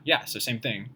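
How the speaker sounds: ordinary delivery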